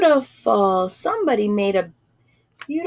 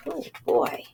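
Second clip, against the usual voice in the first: whispered aside; 6 semitones higher, 6 dB quieter than usual. Real, not acted. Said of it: "reader's aside".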